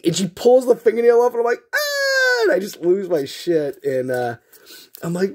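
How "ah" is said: very high mock scream of pain. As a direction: imitate a child's agonized shriek. Nail pulled off, screams in retelling.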